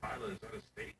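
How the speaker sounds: whispered aside to Isla. Retold or imitated. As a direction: hiss the question under your breath. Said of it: "I was like".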